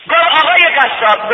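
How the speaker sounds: high, loud chanted preaching; soaring rowzeh-style sermon chant; preaching at full force. Acted. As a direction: lift the journey into a ringing chant.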